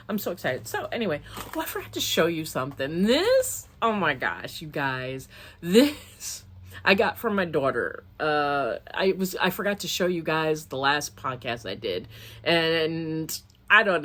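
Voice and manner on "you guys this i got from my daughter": ordinary talk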